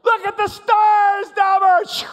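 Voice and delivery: slow, high, full-voiced yell; gleeful all-out shout to the rider; shouting over screams. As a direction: holler it over the roller coaster, stretching the words. Yelling over the screaming on ride.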